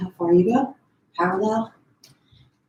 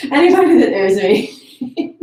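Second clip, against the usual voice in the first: louder, higher, laughing through the words; real laugh breaking into slowed speech; 4 semitones higher, 7 dB louder than usual. Real, not acted. Instructor joking to class about being weird, laughing.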